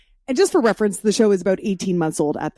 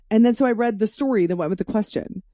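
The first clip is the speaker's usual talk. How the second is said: ordinary talk, nothing marked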